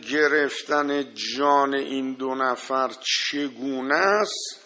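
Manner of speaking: normal talking voice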